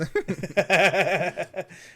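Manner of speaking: giggling laugh. Real, not acted. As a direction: laugh at your own joke. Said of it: "laughing at own joke".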